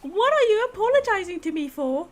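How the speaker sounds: prim lady putting them in place; high stern lady voice. Acted. Character snaps.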